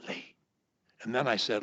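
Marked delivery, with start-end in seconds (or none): 0.1-0.3 Lee whispered with no voice; just whisper Lee, no voice at all. Shown as a demonstration. Coach whispers his name to break a block, whispered. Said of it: "so soft no one can hear it".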